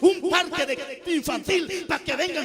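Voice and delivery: loud, high scolding sermon at full cry; rally the congregation, shouting the rebuke; full-force preaching. Acted.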